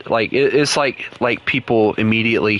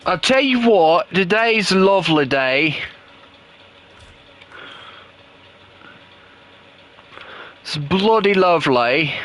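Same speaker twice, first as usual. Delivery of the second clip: British accent; 8 semitones higher than usual. Shown as a demonstration. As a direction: put on an exaggerated British accent, pitched well up. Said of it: "Do the British accent again".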